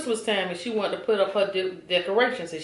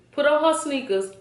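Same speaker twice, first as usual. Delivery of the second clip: usual speaking voice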